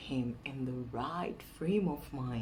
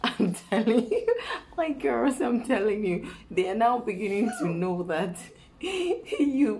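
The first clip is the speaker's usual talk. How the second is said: real laughter through loud, high speech; laughing; 6 semitones higher, 8 dB louder than usual. Real, not acted. Woman confiding to her girls what men now know, laughing. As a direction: laugh while insisting, voice raised. Laughing at the suspicious husband's reply.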